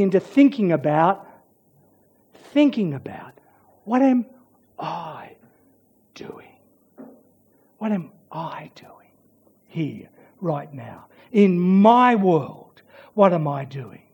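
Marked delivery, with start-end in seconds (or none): 3.8-11.1 soft unvoiced whisper, searching. Preacher whispering so listeners ask themselves. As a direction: hush down and ask it inwardly. Pastor whispers a self-examining question.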